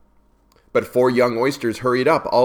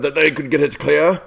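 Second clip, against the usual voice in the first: lightly voiced pompous walrus; a touch higher, hint of the walrus; 5 semitones higher, 4 dB louder than usual. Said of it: "the walrus said".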